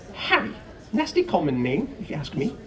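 high, sniffy disdain; scornful. Acted. Petunia sneering at the name Harry, high and scornful.

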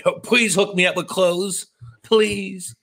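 high begging voice; pleading. Acted. Begging for free clothes.

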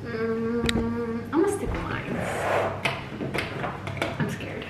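usual speaking voice

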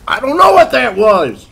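high, very loud yell; panicked shout. Retold or imitated. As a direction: yell back at the startled kid. Acts out panicked reply after blast.

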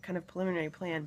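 ordinary talk, nothing marked

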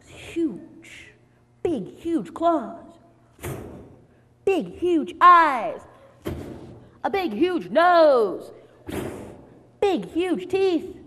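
slow, loud, drawn-out build-up of the monster; dramatic suspense. A narrator's reading. Storyteller to children, revealing the wolf dramatically. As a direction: savor each big huge part.

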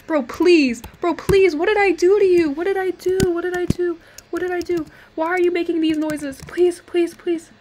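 high-pitched frantic pleading; freaking out. Real, not acted. Gamer pleading with a misbehaving computer, panicked. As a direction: beg desperately, overdramatic, words tumbling in repeats. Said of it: "Sorry for freaking out there".

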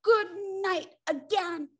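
high, drawn-out exasperated cry; storybook roar, stretched and high-pitched; roared sign-off. A narrator's reading. Bear slamming the door on woodpecker, high exasperated roar. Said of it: "he roared".

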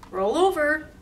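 high, loud command; sing-out order to a pet; trick command. Acted.

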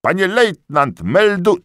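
high, loud servile report; eager corporal. A narrator's reading. Corporal reporting to the lieutenant, high and eager.

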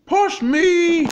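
high, loud push cry; excited effort cry. Acted. Super Tyler pushing the giant fufa, straining. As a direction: heave and shout it out.

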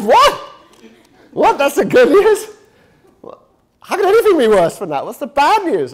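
very high, loud outraged shriek; hammed-up horrified patient; shocked disbelief. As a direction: yelp it in comic disbelief. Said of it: "He goes".

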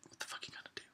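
hushed sneering taunt; menacing whisper. Real, not acted. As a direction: whisper the dare.

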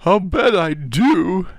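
put-on slow, high childish howl. A narrator's reading. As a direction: wail it out, dragging the words. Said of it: "he howled".

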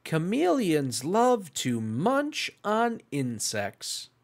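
bright, lively storytime read; picture-book reading voice for young listeners; reading to children. Acted. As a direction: read it like storytime for little ones. Reads picture book to kids.

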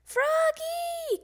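high, drawn-out call; loud high summons. A narrator's reading. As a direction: sing out the name loudly. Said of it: "called Grandma".

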